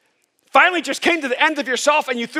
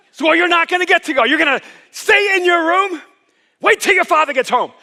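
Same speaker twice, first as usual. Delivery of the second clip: mock angry mom; 7 semitones higher, 6 dB louder than usual. Angry mom voiced in story.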